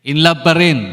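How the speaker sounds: loud call to the congregation; shout. Real, not acted. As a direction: call it out loud, rousing the room. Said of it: "Sumigaw na ako eh". (malakas na sigaw)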